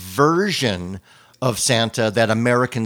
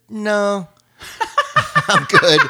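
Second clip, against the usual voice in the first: breezy wife; 11 semitones higher, 5 dB louder, slower than usual. Retold or imitated. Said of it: "And she said".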